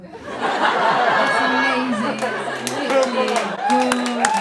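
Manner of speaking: loud, laughing sarcastic rave; mock rave. Comic hyping Croydon to the audience, loud sarcastic rave. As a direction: oversell it with a laugh.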